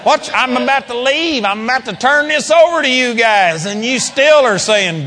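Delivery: exasperated Jesus. Retold or imitated. Jesus scolding disciples continued.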